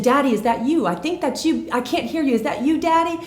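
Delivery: put-on high, anxious child calling out; acted hopeful call toward a distant voice; lost little girl. Scared girl answers a faint voice, high and urgent. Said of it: "And she said".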